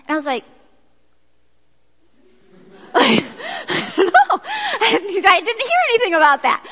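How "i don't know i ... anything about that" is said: answering her panicking friend, laughing; laughing reply. Retold or imitated. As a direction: laugh through the words.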